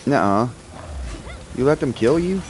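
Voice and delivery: ordinary talk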